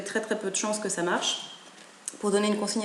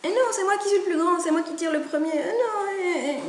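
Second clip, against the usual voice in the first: put-on fast, high child's protest; arguing chick; 9 semitones higher than usual. Big brother chick claims his turn, fast and high. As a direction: rush it out like an indignant big brother. Older chick argues back.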